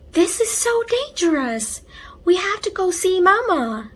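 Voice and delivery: high, loud, urgent alarm; a frightened big sister hurrying her brother; alarmed girl. A narrator's reading. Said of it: "she said, rushing him inside".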